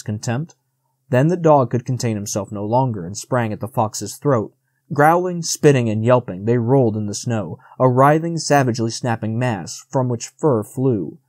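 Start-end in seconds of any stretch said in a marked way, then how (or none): none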